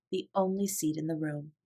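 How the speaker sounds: plain reading voice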